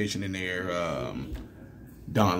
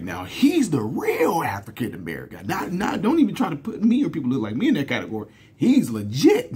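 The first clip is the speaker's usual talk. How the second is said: higher, hammed-up mock protest; big joking proclamation; 5 semitones higher than usual. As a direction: make a big joke of protesting, pitch jumping up. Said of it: "I made a big, huge joke".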